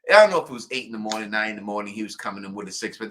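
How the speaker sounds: ordinary talk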